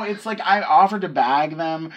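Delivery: ordinary talk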